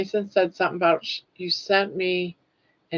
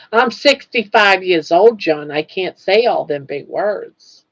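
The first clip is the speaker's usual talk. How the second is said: louder mock old-timer voice; mock self; 3 semitones higher, 8 dB louder than usual. Scene: caller imitating herself complaining to host John, mock loud. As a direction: ham up a helpless oldster. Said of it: "Get me to say".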